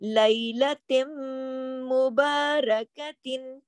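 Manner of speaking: "लैलतिम मुबारकतिन" very slowly, with a long hummed m; ghunnah recitation. Shown as a demonstration. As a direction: draw out "लैलतिम मुबारकतिन", joined by a nasal m. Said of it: "गुन्ना भी हो रहा".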